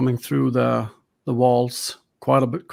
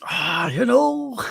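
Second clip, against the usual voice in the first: high, hesitant and slow; nervous friend voice; 8 semitones higher, slower than usual. Acted. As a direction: drag it out nervously.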